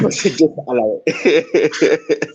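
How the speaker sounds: laughing as you mimic the reply; laughing echo. Guest echoes the host's dismissal of his impression, laughing.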